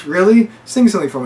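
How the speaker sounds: high, loud excited plea; eager request. Retold or imitated. Ellie begging Joel to sing for her, excited.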